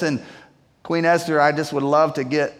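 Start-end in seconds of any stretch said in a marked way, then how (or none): none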